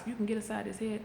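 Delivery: ordinary talk, nothing marked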